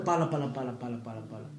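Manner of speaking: fast, low, soft babble; mock rote praying, quick and monotonous; mimicked babbled prayer. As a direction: rattle off meaningless syllables on repeat. Mimicking outsiders' repetitive babbling prayers.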